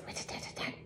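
unvoiced tapping rat-tat; soft breathy woodpecker rattle; whispered woodpecker. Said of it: "sounds like a little hammer".

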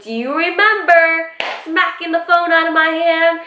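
high-pitched retold accusation; indignant confrontation. Confronting the man over the smacked phone, indignant high voice. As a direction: act out throwing the offence back at him.

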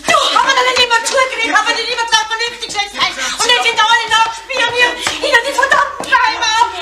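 high-pitched, agitated lament; distraught outburst. Acted. Reproaching Erich for the spying, distraught outburst. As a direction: pour it out in desperation, voice held high.

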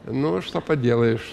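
ordinary talk, nothing marked

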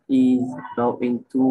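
very slow "is going to"; slowed demo. Shown as a demonstration. Tutor showing friend how to read "is going to", slowly. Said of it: "cứ à, à đấy".

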